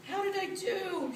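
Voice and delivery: high-pitched, anxious cat. A narrator's reading. Cat asking the emperor how she did, anxious high voice. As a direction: ask it high and worried. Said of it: "she asked anxiously".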